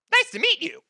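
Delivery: big Kenku squeaking a greeting; squeaky Kenku. Acted. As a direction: pop up into a squeak. Kenku jumps to high voice.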